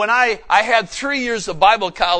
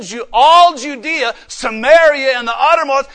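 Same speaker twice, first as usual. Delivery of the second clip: loud proclamation; 5 semitones higher, 8 dB louder than usual. Acted. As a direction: call out each place to the hall. Loud listing then whispered repeat.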